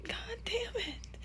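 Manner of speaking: high, slow, whispered through tears; tearful whisper. Real, not acted. Reader curses the book through tears, whispering. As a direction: breathe it out, crying. Overcome by sad scene.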